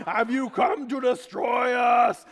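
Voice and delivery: loud, drawn-out mock scream. Congregant suddenly interrupting the sermon, screaming out. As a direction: bellow it slowly like an outburst in church. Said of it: "suddenly just screaming out in the middle of the sermon".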